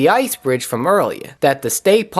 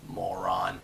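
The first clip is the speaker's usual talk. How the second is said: muttered; 9 semitones lower, 15 dB quieter than usual. Real, not acted. Muttering insult at stubborn character.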